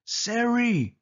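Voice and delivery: very high, shocked shout of her name; shocked cry. Acted. Narrator sees sister rise from coffin.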